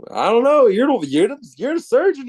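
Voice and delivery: high and loud, scared and helpless; hammed-up panicky squeal to the surgeon; panicked high yelp. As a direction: blurt it up high like you're terrified.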